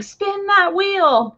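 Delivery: slow and high, playful quote of Mimi; quoting Mimi. Retold or imitated. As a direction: sing out Mimi's cheerful urging. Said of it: "Mimi saying".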